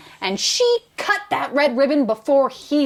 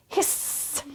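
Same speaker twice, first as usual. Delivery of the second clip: softer, unvoiced hissing cat; 4 semitones higher, 10 dB quieter than usual. A narrator's reading. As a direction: hiss like a fleeing cat. Cat fights on stage.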